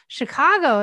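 light Hawaiian pidgin surprise. Retold or imitated. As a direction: imitate the local guy repeating the city. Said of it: "in his pidgin English".

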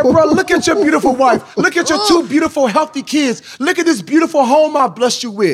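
God listing blessings. Retold or imitated. God reminding the husband of his blessings, high preaching voice. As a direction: count his blessings back at him. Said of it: "He said".

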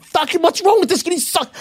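very high, exasperated mock yell; over-invested soccer parent. Soccer dad too emotionally invested, acted high-pitched outrage. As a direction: re-enact losing it at a kid's game. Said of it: "I would get so emotionally invested".

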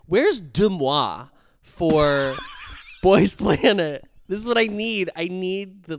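normal talking voice

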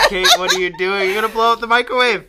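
loud, high, laughing panic; high alarmed laughing warning. Retold or imitated. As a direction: squeal it in mock alarm.

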